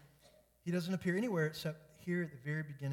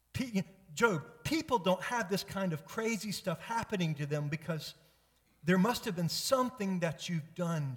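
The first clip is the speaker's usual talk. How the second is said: raised, insistent mock accusation; acted friend pushing blame, higher and louder; accusing friend; 5 semitones higher, 6 dB louder than usual. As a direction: press him hard to admit wrongdoing. Acting Job's accusing friends.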